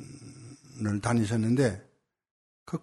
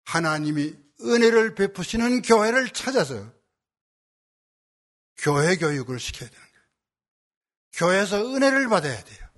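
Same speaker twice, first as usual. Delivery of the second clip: raised-pitch sermon delivery, falling at the close; emphatic preaching; 6 semitones higher, 4 dB louder than usual. Real, not acted. Pastor urging the congregation to seek grace at church, emphatic preaching. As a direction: preach it as an urgent exhortation.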